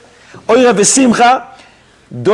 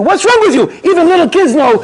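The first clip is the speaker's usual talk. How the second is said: mock rebuke; 10 semitones higher than usual. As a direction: chide him for the sour face. Rebuking someone joyless in Adar.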